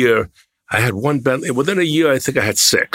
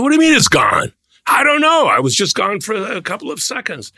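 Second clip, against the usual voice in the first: alarmed; 9 semitones higher than usual. Retold or imitated. Panicked retold car theft exchange.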